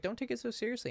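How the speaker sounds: high, soft mock soothing; imitated fake buddy. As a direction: brush it off in a put-on high voice. Imitating friend brushing it off.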